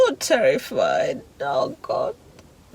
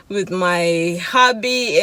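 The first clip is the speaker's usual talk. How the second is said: ordinary delivery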